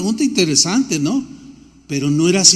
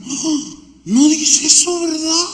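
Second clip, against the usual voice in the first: higher, mock-startled realization; lightly hammed-up surprised reader; mock surprise; 7 semitones higher, slower than usual. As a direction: play the reader suddenly caught out. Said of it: "hacen reacciones así como".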